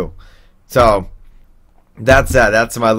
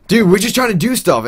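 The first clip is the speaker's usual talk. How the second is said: higher mock whiny friend; mock Ken; 10 semitones higher than usual. Friend defending the group, mock higher whine. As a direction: pitch it up as the annoyed friend.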